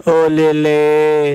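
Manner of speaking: put-on drawn-out cooing, as to a baby; mock-coo very slowly, stretching each syllable; imitating someone fussing over a little child; mimicked baby talk. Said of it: "जैसा कि छोटे बच्चों के लिए करते हैं".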